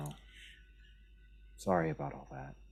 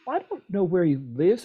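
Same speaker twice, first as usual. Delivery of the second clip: higher, louder protest; panicked blurt; 12 semitones higher, 8 dB louder than usual. Acted. Zanaya panicking at Felicia.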